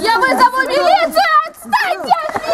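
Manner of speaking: high panicky squeal; frightened squeal. Acted. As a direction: squeal for help in mock fright. Reporter squeals at muggers.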